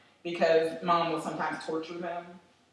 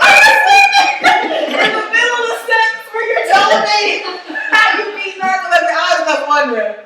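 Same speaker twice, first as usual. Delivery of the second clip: loud, high laughing exclamation; laughing shout; 13 semitones higher, 13 dB louder than usual. Real, not acted. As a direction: shout it while cracking up. Laughing outrage at mom's story.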